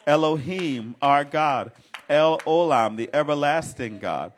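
loud, high, building preacher's cry; preaching at full force. Acted. Preacher crying out God's names to the saints. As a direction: lift the names up like a shout of praise.

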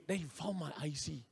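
normal talking voice

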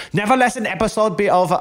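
put-on high, fast camp German accent; bossy camp German accent tossed off quickly. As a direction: camp German accent laying down podcast rules. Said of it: "camp German accents".

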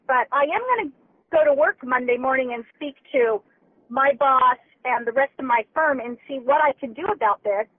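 normal talking voice

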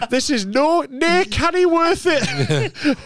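high, slow Geordie lament; hammed-up North East despair; mock Geordie. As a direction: drag it out in exaggerated Geordie. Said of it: "the North East's my favourite".

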